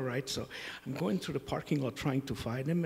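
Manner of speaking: ordinary delivery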